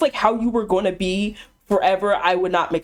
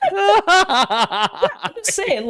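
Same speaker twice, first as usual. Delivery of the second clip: laughing; 11 semitones higher, 3 dB louder, much slower than usual. Real, not acted. Laughing high slow.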